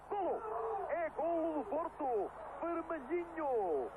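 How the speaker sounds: high, loud, drawn-out goal call; fast dramatic football commentary. Real, not acted. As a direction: scream the goal with joy.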